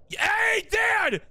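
high shout for Dad; high-pitched holler; calling out. Acted. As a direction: yell ahead to get his attention.

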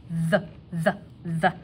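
"the" as a short buzzed th; voiced TH. Shown as a demonstration. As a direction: sound out "the" with voice, tongue between teeth. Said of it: "I have a hard voiced T H".

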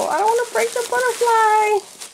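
tiny high worried voice; squeaky fretting. Real, not acted. Crafter fretting over a fragile butterfly, squeaky high voice.

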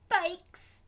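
bursting out with excitement; gleeful cry of the child's wish; excited. A narrator's reading. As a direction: exclaim it like the best news ever. Story exclamation, bikes.

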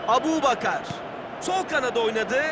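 normal talking voice

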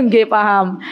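laughing through the reply. Real, not acted. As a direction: chuckle as you answer. Laughs answering audience. (sambil tertawa)

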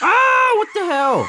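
very high, loud scream into shock; startled scream. Real, not acted. Gamer jump-scared again, screaming. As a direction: shriek at the sudden scare.

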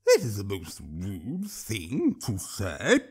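low, slow, slurping troll voice. A narrator's reading. Troll slurps his reply to the angry old woman. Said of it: "the troll slurped".

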